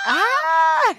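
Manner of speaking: loud, high burst of joy; sudden joyful shout; surprised cry. Real, not acted. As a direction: cry out in delight. Reacting to score of nine.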